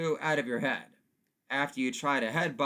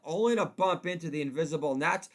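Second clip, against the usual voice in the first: normal talking voice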